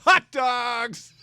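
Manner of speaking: high, far-carrying vendor call; stadium vendor hawking. Retold or imitated. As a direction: hawk hot dogs to the stands. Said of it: "Like she's working at Wrigley Field".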